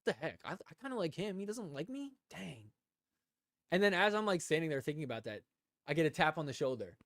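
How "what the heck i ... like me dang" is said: bummed mutter. Retold or imitated. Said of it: "I was like".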